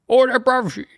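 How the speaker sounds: very high squeaky cockney voice; Alfie impression. Guest imitates Alfie from Peaky Blinders, high cockney squeal. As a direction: squeal it in a silly high London accent. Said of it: "And then Alfie is more like".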